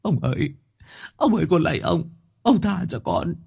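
high, trembling plea not to be sent among ghosts; pleading servant boy. Acted. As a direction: beg the master for mercy as a terrified young servant. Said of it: "Thằng này đau quá van xin".

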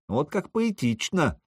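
ordinary delivery

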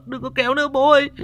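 fast, high, sobbing plea to her father; crying, rushed plea. A narrator's reading. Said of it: "nó vừa khóc vừa mếu máo".

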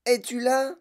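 high call, rising at the end; calling cry. A narrator's reading. Madame Salt calls to Veruca in the hole, calling cry. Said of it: "cria madame Salt".

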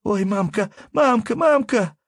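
high, loud wailing for mother; child's wail. Acted. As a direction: cry out like a frightened little child. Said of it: "раздался детский вопль".

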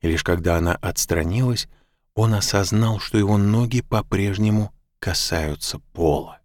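ordinary delivery

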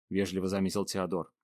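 plain reading voice

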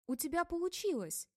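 high, bright burst of joy; delighted. A narrator's reading. Stefania rejoicing at Vera's news, delighted exclaim. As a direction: cheer her success. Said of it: "Обрадовалась я".

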